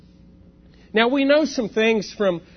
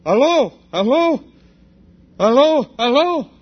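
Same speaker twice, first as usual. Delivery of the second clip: higher, louder "Aló" repeated with a questioning rise; a foreigner trying out the local phone greeting, higher and louder; unsure phone greeting; 5 semitones higher, 5 dB louder than usual. Retold or imitated. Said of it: "I picked the phone up and I go".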